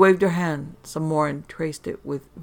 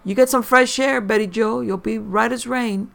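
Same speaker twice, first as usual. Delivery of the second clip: coaxing; 6 semitones higher, 6 dB louder than usual. Acted. Coaxing drugged mother outside.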